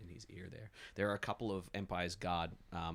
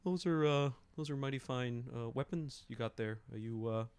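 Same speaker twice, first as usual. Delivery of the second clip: higher, sly sweet-talk to a guard; Zethil sly chat; 5 semitones higher than usual. Acted. Zethil needles a guard about his weapons, higher and coaxing. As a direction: fish for gossip with a higher, friendly voice. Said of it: "you see Zethil ... says".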